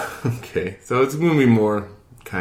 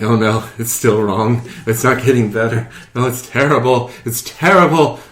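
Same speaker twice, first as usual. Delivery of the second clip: building, louder mock despair; 8 dB louder than usual. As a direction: ham up the heartbreak. Retold growing despair, theatrical.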